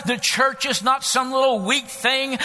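high, loud rallying sermon shout; full-force preaching. Acted. Preacher rallying the church to go out, full-force shouted preaching. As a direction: punch it out in rising revival cadence.

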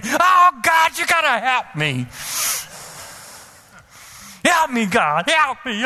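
high, pleading cry to God; emotional plea. Retold or imitated. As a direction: let emotion crack into the plea. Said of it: "my emotions just rose up again".

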